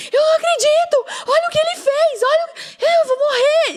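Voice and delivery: very high, loud, tearful shrieking; retold frantic screaming. Wife screaming to her husband over the phone, frantic. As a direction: scream down the phone, beside yourself. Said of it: "eu gritava no telefone".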